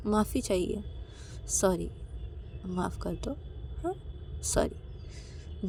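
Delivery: softly pleading sorry; soft apology. Acted. Ananya begs Payal's forgiveness, soft apology. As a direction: drop the voice and ask forgiveness.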